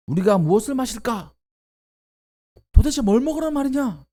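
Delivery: high, loud indignant shouting; angry outcry. Acted. Thirsty people shouting at Moses for water, indignant outcry. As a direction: cry out your demands at him. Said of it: "원망하며 소리를 지르는 겁니다".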